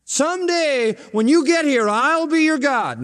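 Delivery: high, exaggerated faraway promise; mock promise. Preacher parodying God promising someday, mock promise. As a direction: dangle it as someday, drawn out at the end. Voices the rejected future-tense reading.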